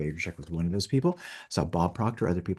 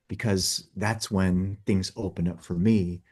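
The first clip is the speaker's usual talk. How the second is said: ordinary talk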